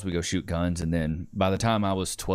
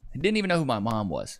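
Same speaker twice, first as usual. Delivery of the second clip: ordinary talk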